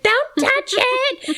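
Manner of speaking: high, slow, drawn-out cry of warning; alarmed warning. Retold or imitated. Mom warning her son not to pull the nail out, high and drawn out. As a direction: stop him in his tracks.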